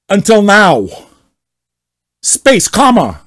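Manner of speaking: hammed-up indignant poster; angry member reading out his complaint; mock outrage. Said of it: "read as much as possible like him".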